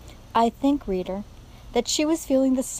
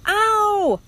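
high, loud yelp of pain; pained scream; 12 semitones higher, 7 dB louder than usual. A narrator's reading. Rat whose tail is cut off, screaming. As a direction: cry out at a sudden hurt. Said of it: "screamed Roscuro".

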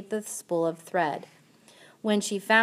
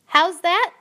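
loud high shouted question; shouting; 11 semitones higher, 8 dB louder than usual. A narrator's reading. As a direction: yell it, not having heard. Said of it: "shouted Mig".

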